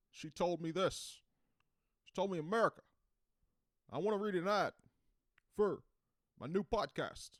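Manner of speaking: high, loud politician impression with halting pauses; Obama. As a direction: impersonate a president addressing America in short bursts. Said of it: "some people say I do Obama".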